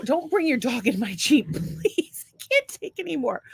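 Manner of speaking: high half-laughing plea; mock pleading. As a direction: beg through a laugh, pitch rising.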